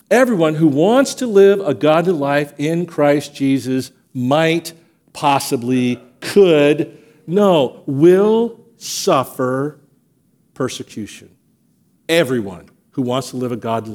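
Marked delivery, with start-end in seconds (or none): 0.1-11.3 slow hesitant buildup. Shown as a demonstration. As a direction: hedge word by word, then land it slowly on will suffer. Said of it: "not some".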